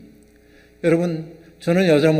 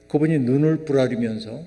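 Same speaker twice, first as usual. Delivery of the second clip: usual speaking voice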